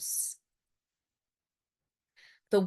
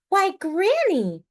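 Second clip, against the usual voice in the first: put-on high, louder girl's voice; Little Red; 12 semitones higher, 6 dB louder than usual. A narrator's reading. Little Red greeting Granny, high girlish. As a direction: call out high like a little girl.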